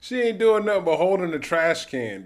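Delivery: high, loud indignant yell; outraged shout. Real, not acted. Watching the woman arrested, he yells indignantly. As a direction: shout it in disbelief.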